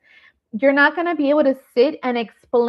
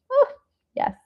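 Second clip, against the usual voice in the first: normal talking voice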